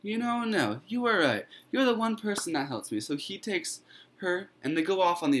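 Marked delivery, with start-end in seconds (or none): 0.0-3.0 put-on pitched higher, earnest boy; grateful Stephen. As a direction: thank her in a raised young voice. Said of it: "And Stephen's like".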